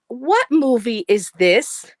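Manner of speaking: slow, earnest, puzzled question; a clueless woman asking in full earnest; serious clueless question. Retold or imitated. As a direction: ask it dead seriously, slowly. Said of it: "she leans over as serious as can be".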